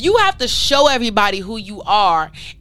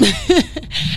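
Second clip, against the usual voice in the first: delighted laugh; 7 semitones higher than usual. Real, not acted. Laughs after hyping herself.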